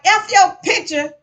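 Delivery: loud, high angry outburst; shouted defiance at the guard; furious retort. Retold or imitated. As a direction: spit it back at her in rage. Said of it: "I'm angry. I'm livid".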